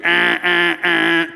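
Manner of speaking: three even air-horn blasts; air horn honks. Retold or imitated. Camp counselor demonstrating the lunch signal, imitated air horn. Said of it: "They get out the air horn".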